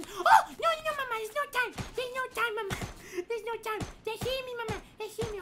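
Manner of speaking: loud and high, urgent; panicked pleading. Acted. Kid being chased in the game, loudly panicking at mama.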